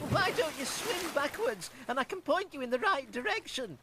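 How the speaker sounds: high, fast, wondering cow; a dreamy blue cow wondering aloud. Acted.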